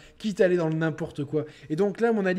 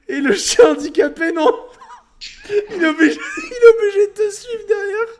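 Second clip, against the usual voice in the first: laughing hard; 7 dB louder than usual. Real, not acted. Laughing fit over a game dog.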